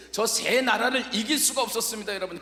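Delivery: usual speaking voice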